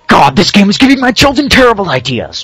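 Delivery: high, loud scandalized voice; outraged parent. Retold or imitated. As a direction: gasp and clutch your pearls. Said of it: "Christians everywhere are going".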